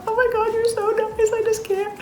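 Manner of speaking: gush, overwhelmed by her kindness. Retold or imitated. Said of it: "I was like".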